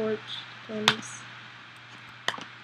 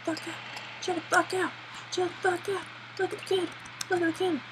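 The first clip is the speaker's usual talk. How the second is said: high, strained plea to chill; high exasperated pleading; 8 semitones higher, faster than usual. Real, not acted. Player pleading with his panicking character, high. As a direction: beg him to calm down, voice up high.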